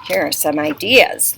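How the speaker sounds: ordinary talk, nothing marked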